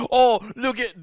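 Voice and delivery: high, loud mock-British taunt; hammed-up jeering; sarcastic mockery. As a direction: make fun of the Brits. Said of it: "sarcastically make fun of the Brits".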